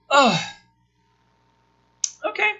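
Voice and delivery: ordinary delivery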